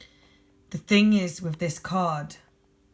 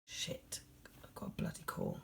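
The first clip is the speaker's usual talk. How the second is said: hushed, much softer swearing under the breath; annoyed whisper; 15 dB quieter than usual. Real, not acted. Reader interrupted by a phone call, whispering irritably. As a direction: whisper it so the caller can't hear. Phone rings during recording.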